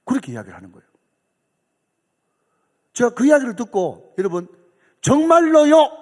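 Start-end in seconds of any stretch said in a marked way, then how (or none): 5.0-5.9 much higher, much louder astonished squeal; a hammed-up, tacky "really?!"; overdone surprise he claims he held back; mock gasp of surprise. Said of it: "그렇게 이야기하면 얼마나 촌스럽습니까".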